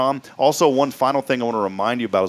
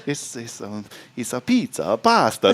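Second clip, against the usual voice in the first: fake Italian accent. Shown as a demonstration. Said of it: "See, you gotta say a pizza".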